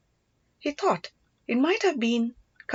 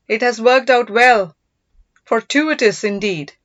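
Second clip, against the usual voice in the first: loud, triumphant announcement to his men; gloating declaration; 2 semitones higher, 7 dB louder than usual. Acted. Marappan tells his horsemen the gems are luck, loud gloat. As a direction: boom it out, pleased with the find. Villain pleased at found gems.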